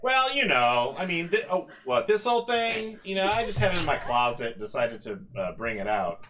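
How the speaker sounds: loud, quick flustered reply; flustered host. Acted. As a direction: brush it off, caught out. GM voicing robot, flustered.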